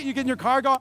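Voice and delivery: ordinary delivery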